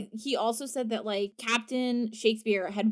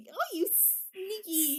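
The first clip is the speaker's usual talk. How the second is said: teasing sneaky; 8 semitones higher, 6 dB quieter, slower than usual. Retold or imitated. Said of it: "and I was like".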